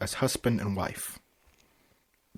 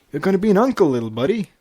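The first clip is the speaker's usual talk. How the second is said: cheerful announcing; 6 semitones higher, 4 dB louder, much faster than usual. Acted. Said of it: "Flash declared".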